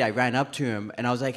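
usual speaking voice

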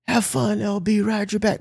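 high calling-out without much volume; mock scream. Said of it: "is screaming out the window".

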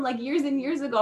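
ordinary delivery

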